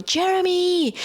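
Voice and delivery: high piercing scream of a name. Retold or imitated. Lowen screaming for Jeremy from the monitor, high alarmed yell. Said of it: "Lowen screams at the top of their lung".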